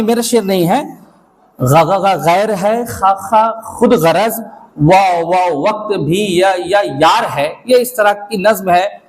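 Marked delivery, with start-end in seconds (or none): 1.6-7.5 repeating the first sounds before each word; stammering lover. Acted. Stammering lover recites his verse to the crowd. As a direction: trip on every opening syllable. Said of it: "एक हकले का प्यार".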